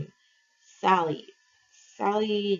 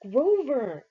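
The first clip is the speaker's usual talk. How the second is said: shout; 7 semitones higher, 4 dB louder than usual. A narrator's reading. Calling Grover again.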